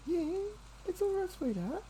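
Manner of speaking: very high, soft cooing. Real, not acted. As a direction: murmur tenderly to calm a frightened pet. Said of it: "I'm gonna talk nice and quiet".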